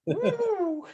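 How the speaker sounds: high-pitched laughing "woo"; high whoop. Real, not acted. Whooping amid shared laughter.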